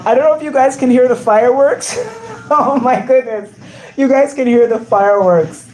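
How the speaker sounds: thrilled by the bangs outside; excited about fireworks. Real, not acted. Fireworks going off during livestream.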